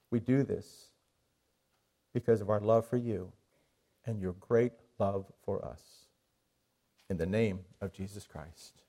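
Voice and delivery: quiet, devout prayer voice; closing prayer. Acted. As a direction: pray gently for the congregation.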